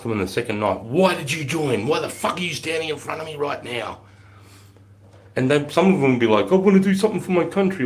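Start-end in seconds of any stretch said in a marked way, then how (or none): 0.8-4.0 grilling a recruit. Retold or imitated. Instructor grilling lazy recruit. 6.5-7.8 very high, louder naive recruit voice; mock earnest recruit. Mimicking recruit's earnest answer.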